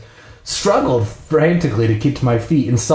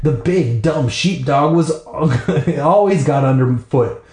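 ordinary delivery, nothing marked

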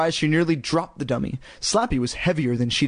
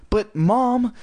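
high-pitched whine of protest; unhappy whine; 9 semitones higher than usual. A narrator's reading. Girl objecting to her mother, whining unhappily. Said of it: "Lindy whined unhappily".

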